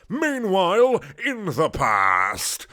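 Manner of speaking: loud, drawn-out announcer call; hammed-up high, loud opening, stretched ending; dramatic scene change. A narrator's reading. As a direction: boom out the transition theatrically.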